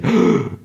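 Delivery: sharp unvoiced intake of breath; a quick imitated gasp; mock gasp. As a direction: gasp in sudden alarm.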